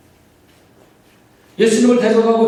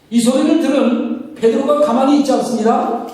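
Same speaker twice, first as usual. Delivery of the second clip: usual speaking voice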